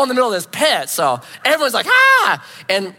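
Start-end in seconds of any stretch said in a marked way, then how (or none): 1.9-2.4 loud high shriek of alarm; a group shriek, voiced by one; startled scream. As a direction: scream as everyone jumps back. Crowd recoiling from snake.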